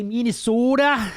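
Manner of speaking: Minnesota with a long, exaggerated Upper Midwest O; teasing Minnesota accent. Shown as a demonstration. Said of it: "I can say that".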